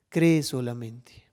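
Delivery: slow whisper, barely breathed. Acted. Preacher whispers God's promise to the listener.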